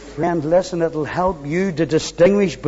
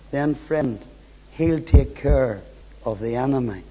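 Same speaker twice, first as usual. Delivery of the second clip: usual speaking voice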